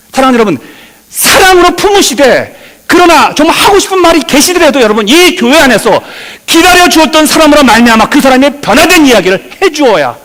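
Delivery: high, loud, urgent exhortation; impassioned preaching. Real, not acted. Pastor urging members to wait for people to change, impassioned preaching. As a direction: plead with the congregation at full pitch.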